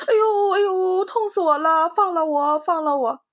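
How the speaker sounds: high, loud mock pained cries; wolf howling in pain. A narrator's reading. Wolf with tail caught begs, high and loud. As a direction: yelp and beg to be let go. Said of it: "痛得哇哇叫".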